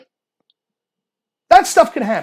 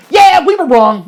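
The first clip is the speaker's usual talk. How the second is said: loud, raised mock concession; mock admission; 4 semitones higher, 8 dB louder, slower than usual. Partisans admitting fault too late, mock conceding. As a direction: imitate a glib confession, louder and higher.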